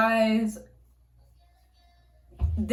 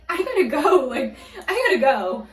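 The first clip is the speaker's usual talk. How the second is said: louder, half-laughing re-enacted urgency; urgent excuse; 5 semitones higher, 7 dB louder than usual. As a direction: blurt it out like you must flee. Urgently leaving the car, retold.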